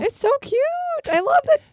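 high, loud squeal of delight; gushing. Real, not acted. Friend squealing over the cute picture wall, high excited gush. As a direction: squeal it, totally charmed.

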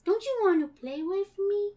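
put-on high child voice slowing into a drawn-out plea; creepy-sweet child, stretching the final words; eerie little girl Sandy. Sandy begging Akira to play forever, slowing eerie child voice.

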